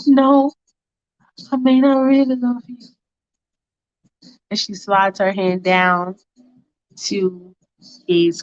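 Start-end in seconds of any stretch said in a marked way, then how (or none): 0.1-2.8 put-on higher girlfriend voice, earnest love plea; Faith pleading. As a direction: lift the pitch and plead with him sincerely.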